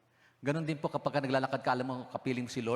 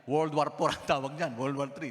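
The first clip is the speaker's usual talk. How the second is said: preacher hams up the joke, louder; joking exaggeration; 3 semitones higher, 5 dB louder than usual. Pastor teases the congregation about couples bickering, mock-dramatic and loud. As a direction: punch out World War Four with a loud laughing lift.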